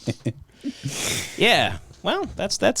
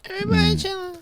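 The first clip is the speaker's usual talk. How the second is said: imitating the plea; 12 semitones higher, 4 dB quieter, faster than usual. Echoing a panicked speaker's plea.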